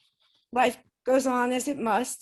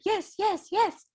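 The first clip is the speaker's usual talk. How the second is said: much higher, lilting mimicked voice; imitated spirit voice; 12 semitones higher than usual. Spirit team answering her question, high imitated voice. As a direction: mimic an eager answer, pitched way up.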